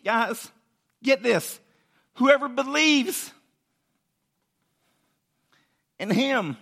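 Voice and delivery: verse proclaimed in short, weighted phrases; emphatic preaching. Real, not acted. Preacher reciting how God gave his only son, emphatic preaching. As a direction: drive each phrase home with pauses between.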